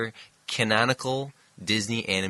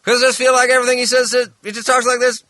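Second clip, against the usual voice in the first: fast, much higher and louder drawled impression; Tommy Lee Jones; 9 dB louder, faster than usual. Doing Tommy Lee Jones for his cohost, mock impression. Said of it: "I have the cadence of Tommy Lee Jones".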